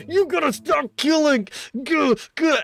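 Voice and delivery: put-on high, loud strangled voice of a raging old camp leader; mock raging old man. Vlogger retelling the film, voicing the old camp leader yelling at the boy, high and loud. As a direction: ham up a furious old man beating a kid.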